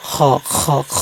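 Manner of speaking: খ scraped from the top of the throat; throaty kha. Shown as a demonstration. Teacher demonstrates Arabic kha to students. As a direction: rasp the খ from the throat's end. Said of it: "হলকের শেষ অংশ থেকে খ উচ্চারণ করবেন".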